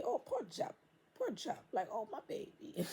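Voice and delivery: soft, high, pitying coo; mock pity. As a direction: coo it like fake sympathy over a pet. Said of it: "Like the Lucian would say".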